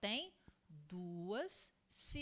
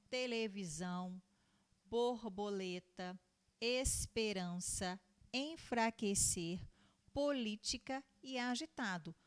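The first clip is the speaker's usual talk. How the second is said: word-list reading. Shown as a demonstration. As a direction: draw out each word for young learners. Teacher reads word list aloud.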